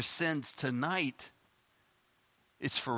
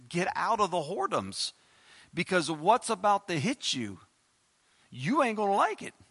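warning call; 4 semitones higher, 3 dB louder than usual. Acted. Warning voiced to sinners.

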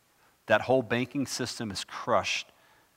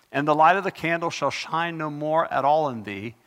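scripture reading; 4 semitones higher, 5 dB louder than usual. Acted. Reads scripture aloud.

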